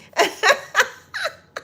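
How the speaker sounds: high shrieking laugh; loud laugh. Real, not acted. Laughing at the viewer's song joke again. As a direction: crack up again, real.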